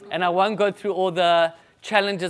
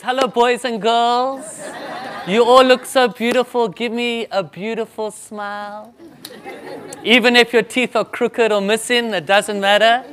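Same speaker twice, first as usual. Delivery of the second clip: put-on louder, higher, beaming at the kids; cheerful school greeting; 4 semitones higher, 5 dB louder than usual. Shown as a demonstration. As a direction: greet the children like a happy host. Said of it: "What I normally say in the school is".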